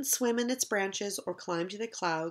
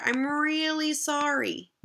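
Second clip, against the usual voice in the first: slow, higher, exaggerated apology; a child's overdone apology; drawn-out sorry; 5 semitones higher, slower than usual. A narrator's reading. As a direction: stretch it out like a kid forced to apologize.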